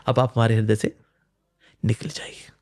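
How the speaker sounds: a tender hushed farewell, trailing into breath; gentle whisper. Shown as a demonstration. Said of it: "सात्विक तरीके से निकाल सकते हैं".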